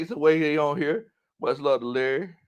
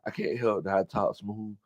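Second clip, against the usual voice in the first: soft, lowered smooth-talker voice; Sadie impression; 5 semitones lower, 9 dB quieter than usual. Reading Sadie's comeback as Sadie, smooth and soft. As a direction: drop low and soft, cool and silky. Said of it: "You sounded exactly like him".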